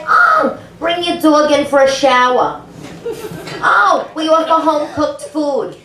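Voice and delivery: high, sugary sales voice; lightly hammed-up welcoming boss; mock sales pitch. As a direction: gush like a pushy recruiter. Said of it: "They're like".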